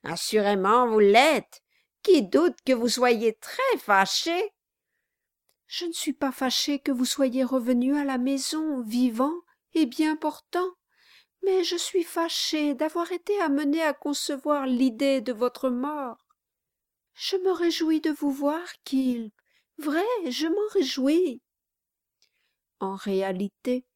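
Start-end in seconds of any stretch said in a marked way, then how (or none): 0.0-4.5 loud, high sarcastic jeering; loud scorn thrown back at the weeping wife; mocking dwarf. A narrator's reading. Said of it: "s'écria le nain". 5.7-21.4 higher, pleading, lightly tearful; mild tearful pleading; a touch of pleading wife. A narrator's reading. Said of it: "en sanglotant".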